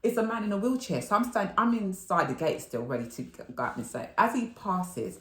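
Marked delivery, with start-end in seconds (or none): none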